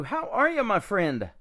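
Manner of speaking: ordinary talk, nothing marked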